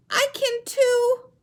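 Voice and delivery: very high, loud, slow penguin squeak; a little chick insisting it can too. Shown as a demonstration. Teacher voicing Little Penguin, high and slow. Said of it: "show you how to use voices".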